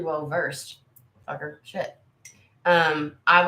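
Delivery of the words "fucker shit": cursing much softer, almost under breath; soft embarrassed curse; muttered curse. Real, not acted. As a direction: grumble it to yourself, embarrassed. Mutters after the compliment.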